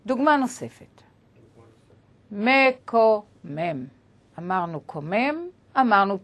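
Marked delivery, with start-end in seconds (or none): 2.3-3.9 מקומם very slowly, split into me-ko-mem; drawn out syllable by syllable. Shown as a demonstration. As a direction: stretch מקומם out, pausing between syllables. Teacher breaks down example word. (מקומם לאט מאוד, הברה אחר הברה)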